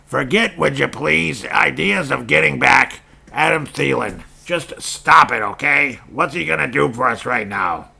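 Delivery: high, loud imitated radio host; mock the host's scolding rant; lightly voiced sports host scolding fans; Patrick Royce impression. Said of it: "as Patrick Royce might say, imagining with his voice".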